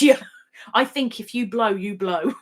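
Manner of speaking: ordinary delivery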